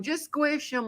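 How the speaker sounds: high, soft imitation of someone unbothered; laid-back. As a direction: toss it off softly, mock-casual. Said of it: "Thomas is laid back going".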